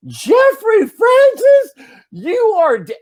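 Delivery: high, loud, drawn-out full-name scolding; scolding old-lady shout. Retold or imitated. Old lady catching a boy at her door, high scolding shout. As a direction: shriek the boy's name slowly like an outraged neighbor. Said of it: "looks at him and goes".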